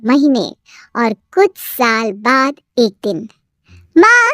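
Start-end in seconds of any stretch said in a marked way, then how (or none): 4.0-4.3 loud, high call to mother; excited call. A narrator's reading. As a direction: shout for mom with joy. Daughter calls out excitedly. (खुशी से पुकार)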